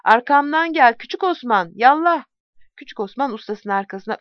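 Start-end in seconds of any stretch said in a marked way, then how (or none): 0.1-2.2 higher, louder rallying call; battle cry. Acted. Deli Bozuk calls Osman to follow him into battle, a higher, louder shout. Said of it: "avaz avaz bağırdı".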